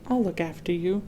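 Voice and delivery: soft, gentle promise; reassuring. A narrator's reading. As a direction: comfort the little creature. Elmer comforting the creature.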